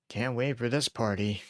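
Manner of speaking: slow, drawn-out sarcasm; sarcastic. Acted. Texting a friend about a dreaded company party, sarcastic. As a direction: feign eagerness, dragging the words sarcastically.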